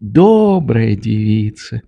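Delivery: slow aside, lightly voiced, not truly whispered; confiding old doctor. A narrator's reading. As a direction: murmur it slowly to a companion. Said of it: "шепнул он мне снова".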